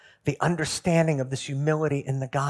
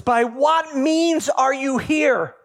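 slow, higher and louder pointed question; stern supervisor angel; 9 semitones higher, 4 dB louder, much slower than usual. Acted. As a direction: look him in the eye and drag it out. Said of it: "the angel looks him in the eye and says".

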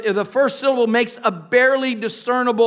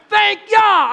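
high, louder call of thanks; 9 semitones higher, 4 dB louder than usual. Shown as a demonstration. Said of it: "I'm not just saying you".

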